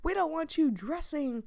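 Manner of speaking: hammed-up cranky grandparents scolding; poor old man impression. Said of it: "He says this in a funny accent, as if he is intentionally doing a poor impression of an old man".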